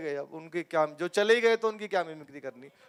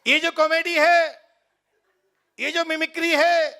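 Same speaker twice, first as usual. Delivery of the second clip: high, loud, earnest politician lecturing the crowd; Rahul Gandhi impression; 5 semitones higher, 4 dB louder than usual. Rahul Gandhi explaining comedy to the audience, high and loud. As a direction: preach comedy like a rally speech, voice raised high. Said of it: "Rahul Gandhi जी ... आपको comedy समझाते".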